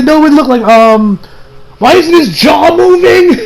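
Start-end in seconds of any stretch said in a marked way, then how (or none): 1.8-3.4 high, slow, incredulous shout; exasperated outcry. Acted. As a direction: cry out in drawn-out disbelief. Exasperated complaint about animation.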